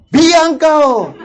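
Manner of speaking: put-on the child spitting the insult right back; mock angry retort. Anak membalas makian ayahnya. (anak membalas makian, tinggi dan keras)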